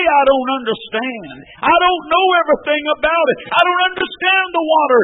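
loud, high preached excuse; mock doubter, shouted. Preacher at full force mimicking a doubter. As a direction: shout the excuse you shouldn't make. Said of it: "you don't say".

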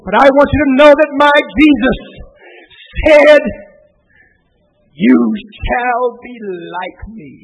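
loud, high shouted preaching; full-force preaching. Real, not acted. Preacher roaring the hymn's message at his congregation. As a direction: preach it hard to the crowd.

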